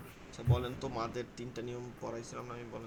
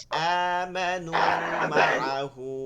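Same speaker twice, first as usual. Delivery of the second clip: very slow, louder model reading of আমানু, then মা আহু; drawn-out word-by-word recitation; 3 semitones higher, 6 dB louder, much slower than usual. Shown as a demonstration. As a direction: stretch আমানু and মা আহু as separate words. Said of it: "আলাদা আলাদা উচ্চারণ করে তারপর মিলাবেন".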